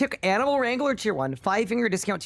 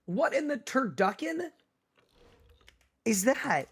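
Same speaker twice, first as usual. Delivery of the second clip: shocked disbelief; 5 semitones higher than usual. Real, not acted. Reacting to a disgusting food.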